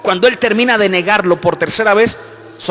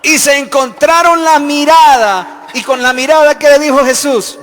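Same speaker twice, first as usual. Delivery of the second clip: loud, higher preaching, slowed for weight; fervent preaching; 9 semitones higher, 9 dB louder than usual. Acted. Preacher dramatizing the rooster's crow to the congregation, loud and fervent. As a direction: drive the moment home to the church.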